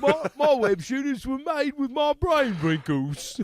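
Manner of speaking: high, far-off put-on actor voice; mock Andrew Garfield. Host parodies the actor for his co-host, high put-on voice. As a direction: play a self-important star bragging. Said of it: "I'm the second Spider-Man".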